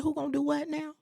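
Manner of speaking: high, incredulous question; can't believe the ask; disbelief. Retold or imitated. Told to jump in, she answers with high disbelief. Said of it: "I said".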